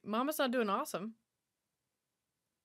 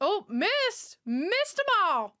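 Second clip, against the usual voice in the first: excited shout; 7 semitones higher, 4 dB louder, much slower than usual. Real, not acted. Reacting loudly to movie scene.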